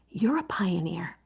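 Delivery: soft unvoiced whisper; whispered to kids. Shown as a demonstration. Teacher whispering to her pupils. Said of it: "this is what I say to them".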